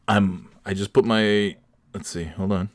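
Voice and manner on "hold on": normal talking voice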